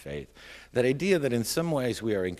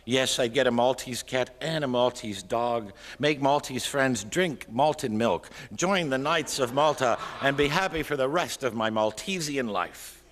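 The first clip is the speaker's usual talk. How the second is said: raised, mock-rapturous recital; exuberant poem reading; 6 semitones higher, 5 dB louder than usual. A narrator's reading. Poet dreaming up a Maltese life, exuberant poem reading. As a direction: let the daydream build, higher and louder.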